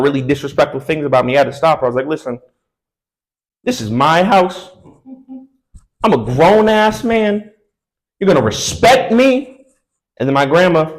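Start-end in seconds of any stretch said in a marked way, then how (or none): 2.1-9.6 slow, higher, firm put-down; mock-stern, spelling it out; firm grown man. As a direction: lay down the law slowly. Asserting himself to girlfriend.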